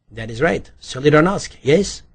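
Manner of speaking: put-on eager Polish-accented agreement, rising tag question; light Polish accent. A narrator's reading. Suspect claims a part in Solidarity, Polish accent. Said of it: "with the lightest trace of accent".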